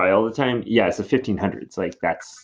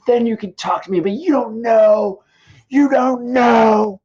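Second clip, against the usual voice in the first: high, loud and building to a shout; Romeo's anguished rant; 8 semitones higher, 5 dB louder than usual. Acted. Romeo yelling at the friar that he can't know, building to a shout. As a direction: rage that nobody understands him.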